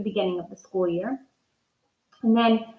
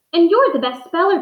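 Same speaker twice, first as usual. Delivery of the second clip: admiring; 7 semitones higher, 6 dB louder than usual. Acted. Patty praising Gilbert.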